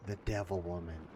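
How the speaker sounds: usual speaking voice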